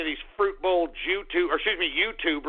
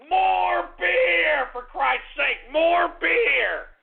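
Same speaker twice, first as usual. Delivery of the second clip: yelling for beer; 9 semitones higher, much slower than usual. Acted. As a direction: holler it across the studio.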